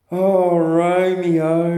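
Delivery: slow, drawn-out sighing call; lovesick sigh. Retold or imitated. Juliet sighing after Romeo as he leaves, slow and lovesick. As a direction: sigh the name out long and longing. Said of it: "then she'd sigh".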